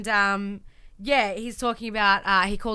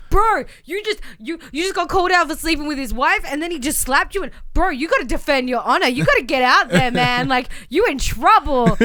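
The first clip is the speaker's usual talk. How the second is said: louder hyped-up bro voice; mock Titus hype; 5 semitones higher, 8 dB louder than usual. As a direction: pump him up, bro. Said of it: "Titus O'Neil comes up and goes".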